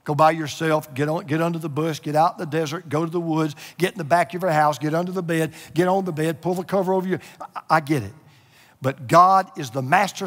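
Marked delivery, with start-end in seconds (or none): none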